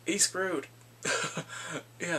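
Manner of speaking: usual speaking voice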